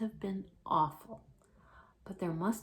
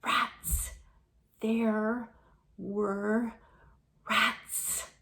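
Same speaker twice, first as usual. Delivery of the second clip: breathy whispered hiss; 3 semitones higher, 4 dB louder, much slower than usual. Acted. Frightened Jane telling Alexander about the rats, hissed whisper. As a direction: hiss it out, haunted. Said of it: "in a strange hissing voice".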